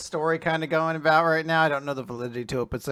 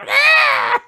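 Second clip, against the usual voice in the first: high, loud hammed-up shriek; mock alarmed yelp; 13 dB louder than usual. As hundreds of dragons arrive, a loud mock shriek.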